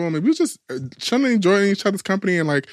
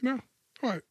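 ordinary delivery

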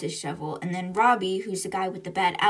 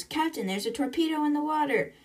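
high-pitched mock yell at usual volume; retold yell; 7 semitones higher than usual. Crewman warns captain of a torpedo, retold yell. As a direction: imitate an urgent warning, pitched up. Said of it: "Jim yells".

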